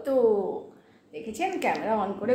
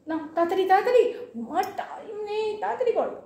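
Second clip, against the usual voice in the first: impatient hurry; 9 semitones higher than usual. Real, not acted.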